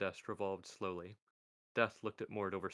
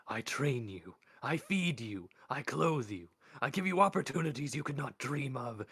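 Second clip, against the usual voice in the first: rising, louder list of grievances; building anger; 4 semitones higher, 4 dB louder than usual. Acted. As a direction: build the rebuke line by line. Death's building rebuke.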